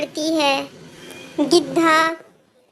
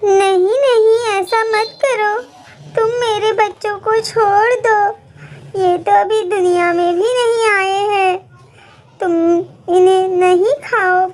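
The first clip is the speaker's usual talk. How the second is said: pleading mother bird; 3 semitones higher than usual. Acted. Mother bird begs the vulture to spare her chicks, tearful. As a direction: beg through tears for her babies.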